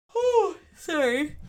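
stretched out through a real yawn. Real, not acted. Podcaster yawns mid-episode, apologizing through a yawn. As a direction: let a yawn carry the words. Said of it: "I yawned".

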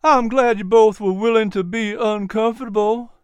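Miz P's voice. Acted.

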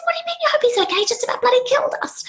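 high, outraged disbelief that drops to a whisper. Retold or imitated. Granddaughter to grandma about the reckless driver, shocked high disbelief. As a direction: squeak out shocked protest, then hiss the rest.

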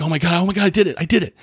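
high, thrilled squeals of disbelief; imitating client's joy. Client hugging coach after landing the box jump, high excited cry. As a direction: burst out like you finally beat the fear.

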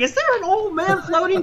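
high, strained puzzled call; heckling onlooker. Acted. Game master voicing onlooker spotting floating captain, high puzzled shout. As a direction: call it out high across the water. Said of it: "some people are like".

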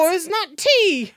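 a touch of an Irish lilt, playful; hint of Irish. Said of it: "can he have an Irish accent".

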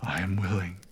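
whispered. A narrator's reading. Father speaks softly of son.

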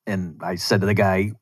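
usual speaking voice

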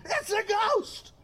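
high, strained, drawn-out mock shriek; mock scream. Preacher acting out terrified disciples seeing a ghost, mock scream. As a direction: scream it in pretend terror without much volume. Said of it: "they even screamed".

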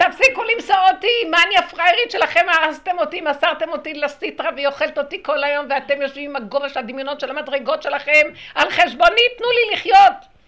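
high, sustained shouted tirade; Shekhinah crying out. Acted. The Shekhinah accuses those who betrayed her, acted high shouting. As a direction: yell it as an outraged plea to be let live. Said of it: "השכינה צועקת".

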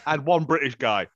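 ordinary talk, nothing marked

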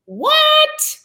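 high, loud squawk of disbelief; hammed-up outraged astonishment; incredulous. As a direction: re-enact total shock, pitch shooting way up. Retold shock at friend's reason.

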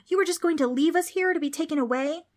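high-pitched outraged question; indignant yell. A narrator's reading. Trix confronts Preston for deserting them, yelling. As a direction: accuse a friend in disbelief. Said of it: "Trix yelled at him".

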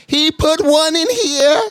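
high, drawn-out tattling voice; cleaning lady. Retold or imitated. Cleaning lady telling police about the stash, mock high voice. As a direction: mimic her calling out, very high and slow. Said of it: "the cleaning lady said".

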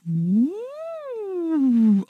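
high sliding woo up and down the range; woo pitch slide. Shown as a demonstration. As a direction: sweep up then down on a woo like a warm-up. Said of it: "So up and down like that".

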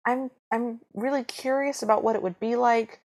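eager Cinderella. Acted. Cinderella accepting her stepsisters' invitation to the ball, eager and hopeful. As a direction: play a gullible, grateful little sister.

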